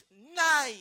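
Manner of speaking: sudden loud high cry of dismay; a loud high burst of embarrassment; loud nein. Retold or imitated. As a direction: blurt it out in mortified shock. Shocked when exposed at table.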